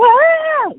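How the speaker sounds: very high short goat scream. Retold or imitated. Cohost asking about goat noises, high goat scream. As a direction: shriek like a little screaming goat. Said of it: "like the little scream".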